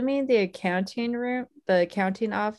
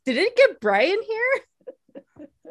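dazzled Jerry; 10 semitones higher, 5 dB louder than usual. Retold or imitated. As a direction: gasp at the brightness. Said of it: "It's like".

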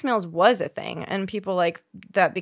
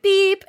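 high, loud electronic beep; spoken beep sound effect; imitated beep; 10 semitones higher, 6 dB louder than usual. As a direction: mimic a call-joining tone. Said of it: "they'll be like".

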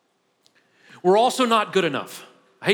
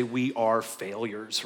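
slow, drawn-out pep-talk chant; a hint of a hammed-up motivational speaker; slow mock seminar mantra; 7 semitones lower, 4 dB quieter, much slower than usual. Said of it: "If I was a motivational speaker".

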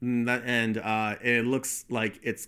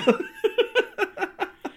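loud high-pitched laugh; 3 dB louder than usual. Real, not acted. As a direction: burst out laughing. Big laugh.

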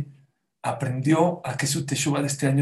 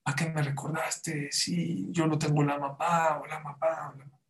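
imitated crying; 2 semitones higher, 7 dB quieter than usual. Grandfather reminded of his tears, imitated crying. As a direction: act out an old man sobbing again, quietly. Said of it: "Y vuelve a llorar".

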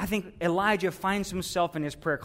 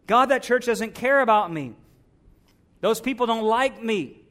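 higher, louder mock grievance; self-pitying complaint; 4 semitones higher, 6 dB louder than usual. Churchgoer telling God nobody cares, in loud self-pity. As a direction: protest to God as the wounded party.

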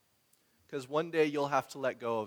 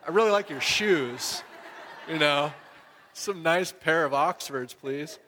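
mock hinting; 3 semitones higher, 6 dB louder than usual. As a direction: play it as a cheeky hint for the shoes. Fishing for a gift of shoes.

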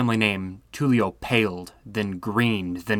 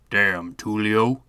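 slow, low rumbling curse; deep rumbling voice; slower than usual. Acted. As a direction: growl it like a heavy drunk. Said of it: "in the deep and rumbling voice of Antonio".

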